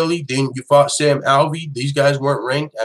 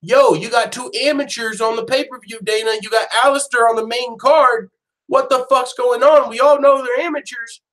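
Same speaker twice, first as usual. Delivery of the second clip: mock fan complaint; 9 semitones higher than usual. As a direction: heckle the boss like an angry fan. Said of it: "what motherfuckers were trying to say".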